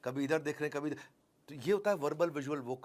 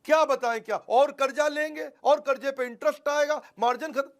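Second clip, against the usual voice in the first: higher and louder, piling up complaints; agitated customer; 9 semitones higher, 9 dB louder than usual. Acted. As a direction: vent like a desperate business owner.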